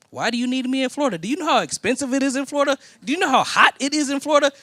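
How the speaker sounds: high, fast, disbelieving objection; incredulous protest. Retold or imitated. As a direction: pitch it up and rush, can't believe it. Protesting going to Florida.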